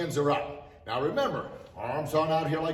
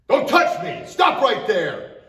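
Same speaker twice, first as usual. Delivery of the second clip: a much higher, much louder commanding shout; ferocious lion voice; 10 semitones higher, 11 dB louder, slower than usual. Shown as a demonstration. Instructor demonstrates self-defense to kids, ferocious shouted warning. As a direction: bellow it to stop an attacker cold. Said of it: "our ferocious lion voice".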